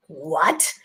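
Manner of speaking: plain reading voice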